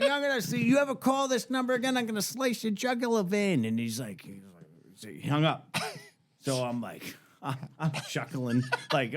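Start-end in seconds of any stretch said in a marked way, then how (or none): 0.0-3.6 hammed-up threat, pitched high; threatening a supposed prankster, high mock menace. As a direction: play the tough guy, voice up high. Doubling down on the threat.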